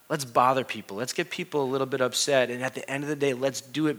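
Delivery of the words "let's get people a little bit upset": ordinary delivery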